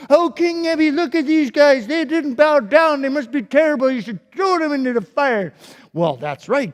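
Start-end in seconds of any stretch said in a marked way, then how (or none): 0.1-5.5 high, loud, wheedling tattletale; tattling rascals. Acted. Rascals tattling to the king, whiny high tattle. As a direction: sneak to the king and snitch. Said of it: "they came and they told King Nebi".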